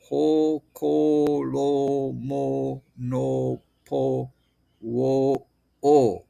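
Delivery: "ho, ko, lo, mo, no, po, wo, o" each with a long "oh"; syllable demonstration. Shown as a demonstration. Teacher showing the vowel O, drawn-out even syllables. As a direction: sustain "ho, ko, lo" evenly, nearly chanted. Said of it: "will be pronounced".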